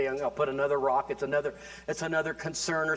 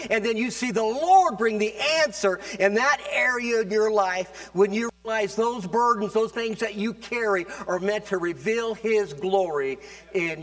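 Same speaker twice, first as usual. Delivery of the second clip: loud, higher preaching; preaching at full force; 6 semitones higher, 5 dB louder than usual. Acted. Pastor urging his church, preaching loud and high. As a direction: drive it home to the congregation.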